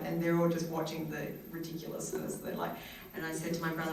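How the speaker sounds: normal talking voice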